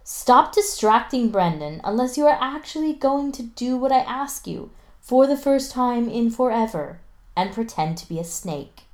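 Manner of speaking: exasperated teacher telling off a pupil; teacher yelling. A narrator's reading. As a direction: scold the distracting student. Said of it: "Miss Elliot yells".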